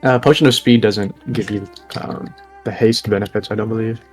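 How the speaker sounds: ordinary talk, nothing marked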